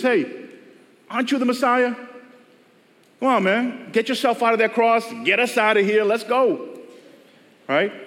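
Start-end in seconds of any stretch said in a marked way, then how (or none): 0.0-6.8 higher, jeering call to the man on the cross; mocking taunt. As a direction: taunt him, a mocking criminal. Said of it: "one of them starts mocking Jesus and says".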